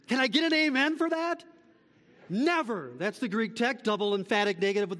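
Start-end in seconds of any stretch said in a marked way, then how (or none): 0.1-1.4 high, quick call for an amen; preacher's call-out. Real, not acted. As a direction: rouse the congregation, pitch jumping up. Calling congregation for an amen.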